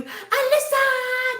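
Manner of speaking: high drawn-out squeal of a name; long, high-pitched excited hello; excited greeting. Real, not acted. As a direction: call out to a friend, stretched and delighted.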